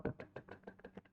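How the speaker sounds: breathy whispered "dek, dek" like a pounding heart; whispered heartbeat. Retold or imitated. (bisikan meniru detak jantung)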